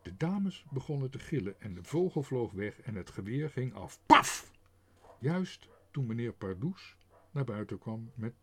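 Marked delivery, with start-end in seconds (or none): 4.1-4.5 loud sudden gunshot burst; loud gunshot noise; gunshot paf. A narrator's reading. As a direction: bang it out. Gunshot sound effect.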